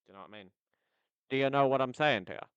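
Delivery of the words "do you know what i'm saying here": very loud, booming on the mic; much louder, right up on the mic; too close to the mic. Real, not acted. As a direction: blast it right into the microphone. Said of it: "sorry for being that close to the mic".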